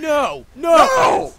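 dismayed shout. Real, not acted. Player reacting to a crash, dismayed shout. Loses out in the race.

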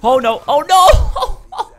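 shrieking oh no at high pitch, half laughing; a real shriek as he dies. Real, not acted. Gamer dying mid-match, shrieking oh no.

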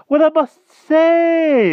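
very high, loud, drawn-out bluster; exclaiming. A narrator's reading. King takes in news of a joust, exclaiming. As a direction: exclaim slowly at the top of the voice. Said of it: "exclaimed the king".

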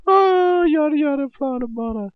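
squeaky babble. Pretend. Goofing off in an outtake, squeaky gibberish. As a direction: squeal the nonsense like a cartoon.

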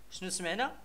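usual speaking voice

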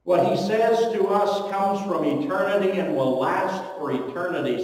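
ordinary talk, nothing marked

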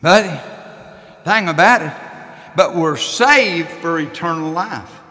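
slow down and proclaim it; preacher pointing the church to scripture; preaching. Acted. Preaching about salvation.

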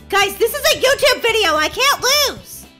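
loud, high protest to viewers; exasperated plea. Real, not acted. Gamer losing in front of viewers, exasperated plea. Pleads while falling behind.

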